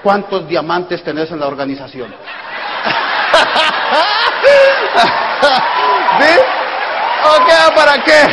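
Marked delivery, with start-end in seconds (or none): 2.2-6.2 big high loud laugh; loud laughing shout. Real, not acted. Big laugh at own joke. 6.2-8.2 higher, louder mock challenge; loud playful taunt; teasing shout. Real, not acted.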